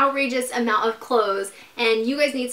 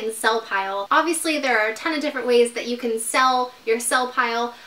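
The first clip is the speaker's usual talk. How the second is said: ordinary talk, nothing marked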